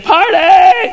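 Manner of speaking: high, loud drawn-out whoop; party cheer. Acted. Angels worshiping God misquoted as revelers, loud cheer. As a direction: belt it out as a joke.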